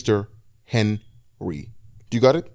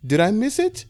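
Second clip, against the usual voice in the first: put-on very high, sulky old woman; high sulky old-lady question; sulking old lady; 12 semitones higher than usual. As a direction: pout it out high and small. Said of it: "sulked a little and said".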